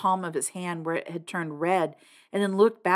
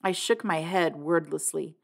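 ordinary delivery

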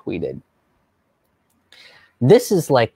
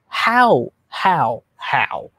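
stunned disbelief; much slower than usual. Real, not acted. Disbelief at racist assumption.

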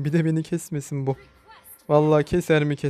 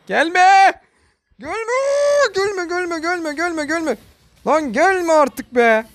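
panicked shriek; 9 semitones higher, slower than usual. Real, not acted. Gamer shrieking at an enemy who keeps chasing him, panicked. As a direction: shriek as if cornered and desperate.

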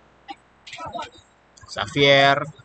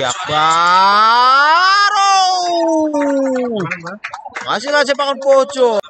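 excited call; 10 semitones higher, 4 dB louder, much slower than usual. Real, not acted. Near miss, corner kick.

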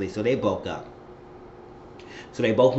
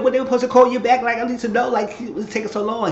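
high, loud and fast fretting; anxious rush; 13 semitones higher, 6 dB louder than usual. Retold or imitated. Mother fretting over a late callback, high and fast. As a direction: blurt it out, too worried to wait. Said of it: "He said".

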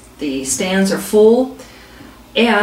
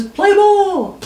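higher umpire call, lightly hammed-up; umpire calling play ball; 10 semitones higher, 3 dB louder than usual. Umpire restarts the game, hammed-up umpire call. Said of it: "Umpire says".

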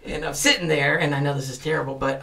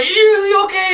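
imitating an alarmed call, much higher and louder; worried shout; 13 semitones higher, 7 dB louder than usual. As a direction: call out anxiously to someone on the floor. People checking on him.

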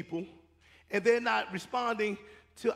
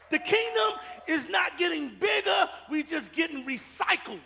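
higher, louder climactic preaching; preaching at full force; 6 semitones higher, 4 dB louder than usual. Acted. Preacher urging church to reach the unchurched, shouting climax. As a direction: drive it home, climbing.

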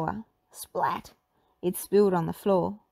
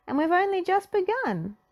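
Shaggy voice; 11 semitones higher than usual. A narrator's reading. Shaggy tasting the veggie pie, high and delighted. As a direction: savour it.

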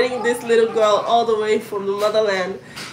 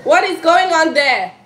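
calling out; 7 semitones higher, 5 dB louder than usual. Real, not acted. Mother calling to kids offscreen.

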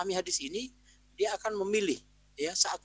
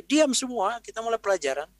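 raised, higher classroom command; commanding teacher; 7 semitones higher, 6 dB louder than usual. Acted. Teacher silencing a noisy class before lessons, commanding call. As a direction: call the room to order, loud at first.

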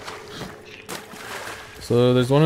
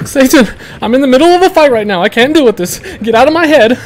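high loud panicked shouting; freaked out yelling; 11 semitones higher, 9 dB louder, slower than usual. Real, not acted. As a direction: shout at the creepy voice in real fright. Spooked by enemy voice changer.

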